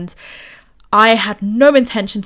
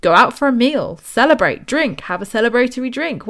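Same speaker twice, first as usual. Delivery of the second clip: usual speaking voice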